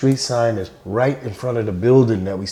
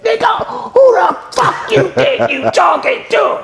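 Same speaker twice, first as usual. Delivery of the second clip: much higher, hammed-up enraged threat; slow, snarling menace, acted out big; imitating a furious Joe Jackson; slower than usual.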